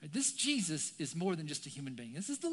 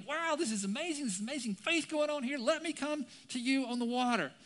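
high-pitched excited gush; adrenaline high; 7 semitones higher, 3 dB louder than usual. Acted. Peter thrilled at walking out to Jesus, excited. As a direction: gush as if carried away by the moment. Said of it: "he's on the high of adrenaline".

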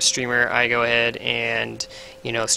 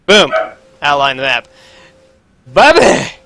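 silly shout; 7 dB louder than usual. Pretend. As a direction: belt out a goofy name.

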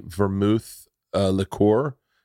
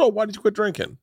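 light surprised question; 10 semitones higher than usual. Retold or imitated. Retold casual question to guest.

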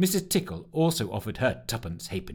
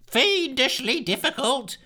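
much higher, slow wail; 13 semitones higher, slower than usual. Acted. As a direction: wail it in dismay. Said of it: "wailed Lushthorpe".

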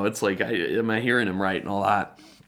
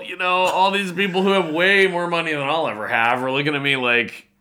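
usual speaking voice

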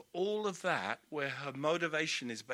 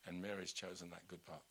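gently; 11 semitones lower, 18 dB quieter than usual. Retold or imitated. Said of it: "Jesus says to her".

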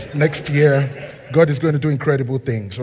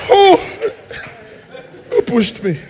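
laughing question; 13 semitones higher than usual. Acted. Teacher facing unruly kids.

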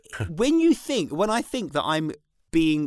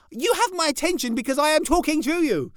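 high, pointed mock scolding; lightly hammed exasperated dad; exasperated parent; 12 semitones higher, 4 dB louder than usual. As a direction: insist you have her attention.

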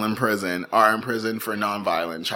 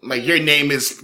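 ordinary talk, nothing marked

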